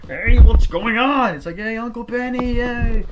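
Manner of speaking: very high, loud happy greeting; cheery shout. Retold or imitated. Family greeting Uncle Benny on arrival, high and loud.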